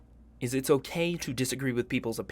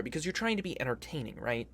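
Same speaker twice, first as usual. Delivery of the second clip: ordinary talk, nothing marked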